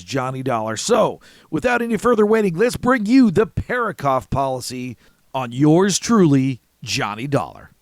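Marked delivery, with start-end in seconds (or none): none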